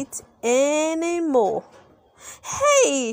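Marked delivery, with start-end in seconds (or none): 2.4-3.1 fan shout. A narrator's reading. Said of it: "shouted a fan".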